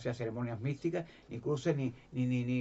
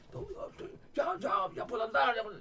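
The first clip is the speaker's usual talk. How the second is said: agudo y algo más fuerte, de júbilo; alegría imitada; 10 semitones higher than usual. Retold or imitated. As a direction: grita feliz como el que vuelve a andar. Curado celebra que anda. (júbilo agudo imitado)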